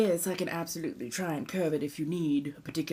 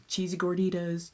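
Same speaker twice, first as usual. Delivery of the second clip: ordinary talk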